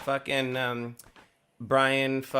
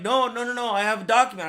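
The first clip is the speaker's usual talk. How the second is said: high-pitched retold protest; urgent protest; 8 semitones higher, 4 dB louder than usual. Process server answering the yelling husband, urgent protest. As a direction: plead your case over his yelling.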